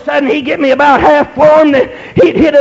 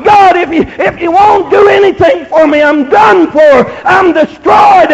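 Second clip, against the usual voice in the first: raised, urgent retold plea; desperate prayer; 2 semitones higher, 4 dB louder than usual. Preacher retelling his last-chance prayer to God, desperate. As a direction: plead with God in a mounting preacher's cry. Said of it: "And I said".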